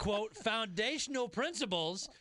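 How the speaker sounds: sarcastic quote, cracking up; can barely say it for laughing. Real, not acted. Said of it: "I can't keep a straight face with this".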